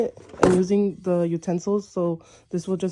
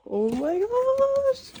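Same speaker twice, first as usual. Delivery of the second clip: very high, drawn-out squeal; excited squeal; much slower than usual. Real, not acted. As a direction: let the last word soar into a long squeal. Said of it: "this is so exciting".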